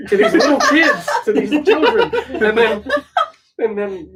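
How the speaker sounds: high, loud laughing while talking; can barely get the words out for laughing; giggling through the punchline. Real, not acted. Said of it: "laughing through the story".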